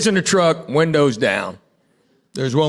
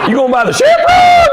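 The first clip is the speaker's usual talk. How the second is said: much higher, louder shocked shout; shocked local; 5 dB louder than usual. Retold or imitated. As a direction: squeal it in disbelief.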